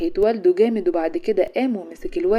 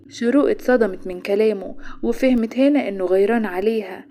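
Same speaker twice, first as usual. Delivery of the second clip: plain reading voice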